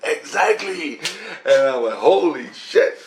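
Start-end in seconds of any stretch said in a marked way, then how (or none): 0.0-1.0 high-pitched, triumphant confirmation; pitched-up exclamation; retold emphatic agreement. Acting out Christ's emphatic agreement. 2.0-3.0 slow, louder awed exclamation; stretched-out mock astonishment; retold amazement. Acting out own awed realization.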